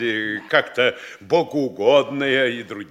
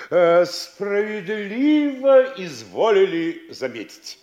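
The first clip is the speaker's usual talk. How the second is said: high, drawn-out obsequious agreement; fawning agreement; 5 semitones higher, slower than usual. Acted. Mayor agreeing with his important guest, fawning. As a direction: stretch it out to flatter him.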